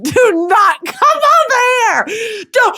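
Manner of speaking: high, loud laughing warning; laughing shout. Acted. Drunk comedian shouting at an approaching fan, laughing. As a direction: keep them away, shouting through laughter. Warding off the swimmer.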